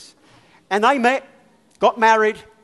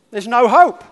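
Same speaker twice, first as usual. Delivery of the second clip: blunt verdict, louder; 5 semitones higher, 10 dB louder than usual. Retold or imitated. Doctor's blunt verdict, retold.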